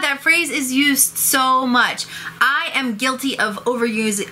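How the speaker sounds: ordinary talk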